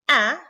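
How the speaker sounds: "a" said like the a in "tablet"; a bright short "a"; modelling the fatha vowel. Shown as a demonstration. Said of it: "is pronounced as a in tablet".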